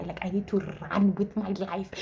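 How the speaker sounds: whispered plan to escape; secret whisper. Retold or imitated. Planning to get away from the angry crush, whispered. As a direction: whisper it so he can't hear.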